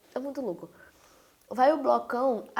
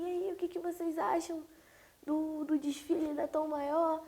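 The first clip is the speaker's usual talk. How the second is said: higher-pitched imitation of an eager reporter; mock excited reporter; 6 semitones higher, 5 dB quieter than usual. Reporter to two ladies at the parade, mock excited. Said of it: "o repórter todo empolgadão falando assim".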